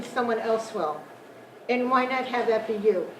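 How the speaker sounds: ordinary talk, nothing marked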